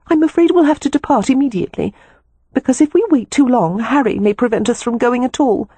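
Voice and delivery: high-pitched, urgent woman's voice; anxious sister. A narrator's reading. Sister urges brother to leave immediately, anxious. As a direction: urge haste in a high voice.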